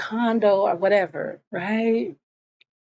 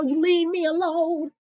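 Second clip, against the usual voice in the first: higher, indignant quarrel voice; retold angry protest; 7 semitones higher than usual. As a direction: act out an indignant rebuff. Retold quarrel over the husband.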